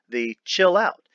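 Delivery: normal talking voice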